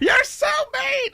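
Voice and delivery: very high, slow, shouted squeal; high, drawn-out mock child's wail; mock whiny daughter. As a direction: wail it like a pouting kid. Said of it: "her go".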